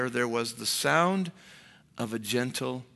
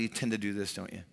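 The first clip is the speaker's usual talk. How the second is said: ordinary talk, nothing marked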